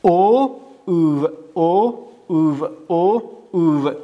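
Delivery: slow stretched او; teacher drilling the vowel او; drawn-out oo. Shown as a demonstration. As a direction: hold او long.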